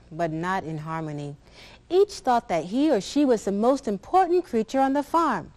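ordinary delivery, nothing marked

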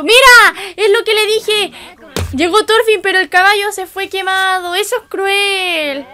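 high, loud outcry that eases off; real dismay. Real, not acted. Indignant at horse burned in show.

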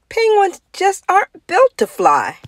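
a hint of a high, sympathetic teacher; sorry teacher. A narrator's reading. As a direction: break the bad news kindly. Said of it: "said teacher".

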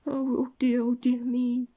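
high, loud theatrical wail. A narrator's reading. Mister Mean sees his money turned to potatoes, wailing. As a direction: lament in hammed-up despair. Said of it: "he wailed".

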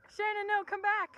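very high, very loud calling; dramatic shout. Pretend. Vlogger calling after running friend, mock desperate shout. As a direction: scream after her dramatically.

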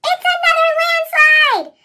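high, loud panicked warning; alarmed cry. A narrator's reading. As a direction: shout the danger in fright. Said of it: "Percy peeped".

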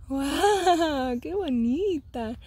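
high, slow, tender praise. Real, not acted. Mom praising her toddler's face, slow tender coo.

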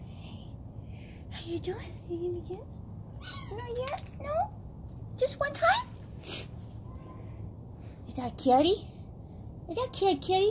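high, soft pet-talk. Real, not acted. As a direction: coo softly and high to a shy dog. Coaxing the dog for a kiss.

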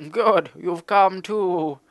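high, slow creature voice; animal voice. Acted. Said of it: "give him a really animalist voice".

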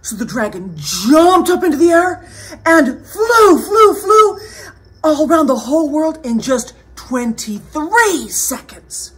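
loud, high, swooping telling; excited storyteller narrating a flight; dramatic story narration. A narrator's reading. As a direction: send each "flew" soaring.

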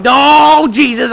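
loud, high panicked exclamation; alarmed cry. Real, not acted. Gamer pinned in the cage, alarmed cry. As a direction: cry out as danger lands.

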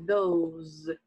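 those, ending in a buzzing Z; those with Z sound. Shown as a demonstration. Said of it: "som de Z no final". (those com som de Z no final)

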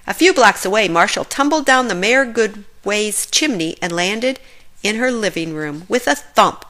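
ordinary delivery, nothing marked

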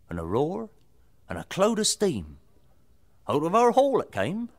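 ordinary delivery, nothing marked

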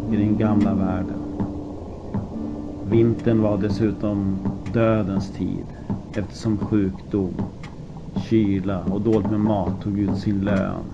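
usual speaking voice

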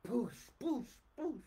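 booming mouth-noise blasts; imitated blast. Preacher imitating God's war on Babylon with booming blasts. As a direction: make the sound of explosions.

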